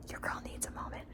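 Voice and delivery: sudden drop to a whisper; confiding whisper. Real, not acted. Excusing the secret Florida trip to viewers, confiding whisper. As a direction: whisper it like a private admission.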